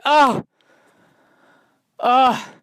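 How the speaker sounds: high, loud bird calls; imitating a bird's loud high wail; bird cry. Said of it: "That's what the bird was doing".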